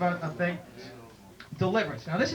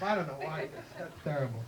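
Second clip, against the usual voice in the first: normal talking voice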